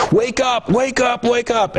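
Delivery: high, pleading wake up, said three times; a wife begging her dead husband to wake; desperate wife. Retold or imitated. Wife at the bedside begging her dead husband, high desperate plea. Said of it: "a wife come right up to the head of the patient".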